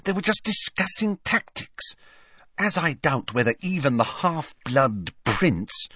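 put-on high-pitched scornful girl student. A narrator's reading. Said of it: "said Hermione scornfully".

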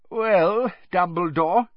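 a smooth, menacing tease; sly warning. Acted. Karkaroff voiced in audiobook.

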